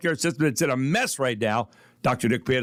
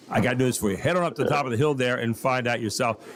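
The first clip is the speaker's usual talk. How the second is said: ordinary talk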